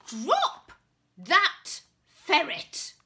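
loud, high stern command. Acted. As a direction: order it, slowly and firmly. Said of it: "commanded the knight".

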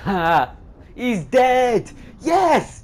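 shouting in triumph, laughing; triumphant shout. Real, not acted. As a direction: yell it out, thrilled. Victory cry after killing target.

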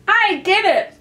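high, loud hasty interruption; hurried. A narrator's reading. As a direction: blurt it in a rush. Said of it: "interrupted in a great hurry".

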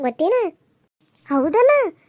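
usual speaking voice

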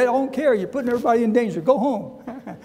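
high, very fast mock scolding; hammed-up neighbor brushing off the permit; angry neighbor yelling. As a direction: snap it back like the fed-up neighbor. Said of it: "He said".

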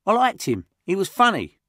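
put-on high, loud tough little boy; a scrappy kid who feels a pang; little kid Josh. Little Josh mourning the taken boy, high kid voice. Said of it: "little kid called Josh".

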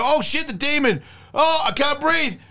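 hammed-up terror of someone who can't feel breathing; mock panic. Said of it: "and you go".

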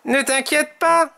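high-pitched reassuring character voice; reassuring Tom. A narrator's reading. Tom comforts his friend, high reassuring voice. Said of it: "le rassure Tom".

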